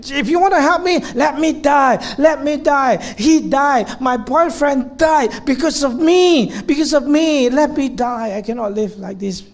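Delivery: high, loud anguished refusal; patient rejecting the therapist in anguish; defiant despair. Retold or imitated. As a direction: push the doctor away, desperate and raw. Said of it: "and she said".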